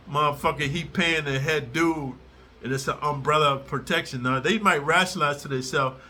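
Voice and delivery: normal talking voice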